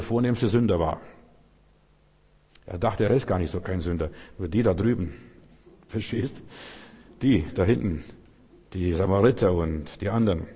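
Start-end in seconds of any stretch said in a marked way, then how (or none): none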